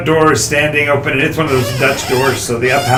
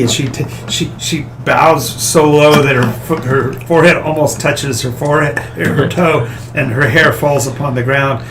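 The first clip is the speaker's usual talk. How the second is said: ordinary talk, nothing marked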